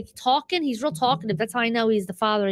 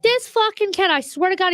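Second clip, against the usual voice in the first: exasperated outburst; 8 semitones higher, 3 dB louder than usual. Real, not acted. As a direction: pitch jumps high on an exasperated rant. Exasperated rant about stray cat.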